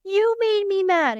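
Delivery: put-on high, loud, drawn-out kid complaint; upset child's voice. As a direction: imitate an accusing child, slow and loud. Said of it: "if they say".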